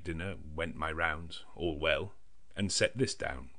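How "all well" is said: ordinary delivery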